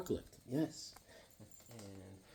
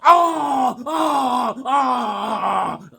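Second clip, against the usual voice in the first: high pained yelps; hammed-up cries of pain; mock screams; 8 semitones higher, 5 dB louder, much slower than usual. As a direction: shriek like being hurt. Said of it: "I'm like this".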